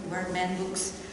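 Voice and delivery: ordinary talk, nothing marked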